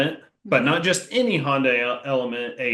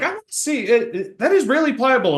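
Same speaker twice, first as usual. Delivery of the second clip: high, surprised retold exclaim; quick startled discovery; 11 semitones higher, 4 dB louder, faster than usual. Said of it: "He was like".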